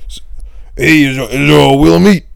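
loud hammed-up character bit call; Scorch. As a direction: belt out a bit title in a put-on voice. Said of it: "Scorch's old bit".

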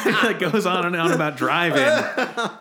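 loud, high, drawn-out telling through laughter; laughing. Real, not acted. Telling co-hosts the anecdote, laughing hard.

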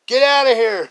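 high, loud shout to get out; high loud angry shout; bartender yelling. Retold or imitated. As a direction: throw the kids out with a yell. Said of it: "bartender throwing kids out".

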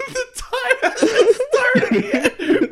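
high, slow words through laughter; laughing. Real, not acted. Laughing echo.

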